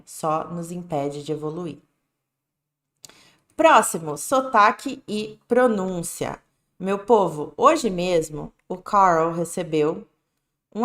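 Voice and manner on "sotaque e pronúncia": usual speaking voice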